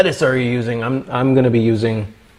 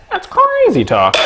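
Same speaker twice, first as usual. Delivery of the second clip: crazy talk; slower than usual. Acted. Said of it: "repeats crazy, second much higher".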